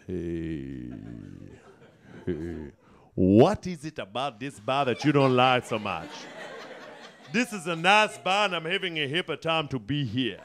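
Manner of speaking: low, put-on South African accent; hammed-up South African voice. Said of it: "who thinks it's funny to do that voice".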